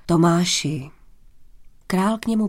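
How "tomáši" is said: name said low and restrained, falling; mild address. A narrator's reading. King approaching Tomáš in the hall, mild address. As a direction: address him gently, without raising the voice. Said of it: "hlas měl najednou mírný a laskavý".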